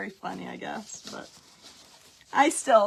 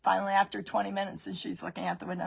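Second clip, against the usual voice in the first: usual speaking voice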